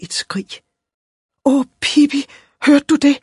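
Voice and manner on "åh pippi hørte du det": put-on high-pitched girl's alarm; horrified. A narrator's reading. Sweet little girl alarmed for the tree, asking Pippi, horrified. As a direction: cry it out aghast, up high. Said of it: "sagde hun forfærdet".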